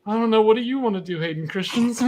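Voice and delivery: flustered and pitched high, fumbling; nervous fan. Retold or imitated. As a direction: act starstruck, voice jumping up. Nervous fans meeting their idol.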